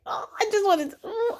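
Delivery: lightly voiced mock-innocent girl; mocking high voice. Mocking Lindsay's excuse.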